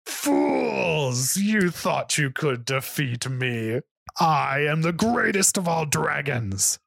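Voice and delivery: slow, high triumphant dragon; fire-breathing dragon. Acted. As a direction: gloat over the fallen hero. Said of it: "let out a mighty roar".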